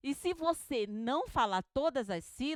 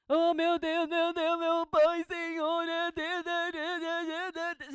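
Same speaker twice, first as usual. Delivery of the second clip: higher, louder ecstatic preacher wailing; mock preacher; 5 semitones higher, 4 dB louder, slower than usual. Teacher mimicking a preacher at the microphone, fervent and loud. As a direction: ham up a fervent preacher crying out to God. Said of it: "Você já ouviu uma pessoa pregando".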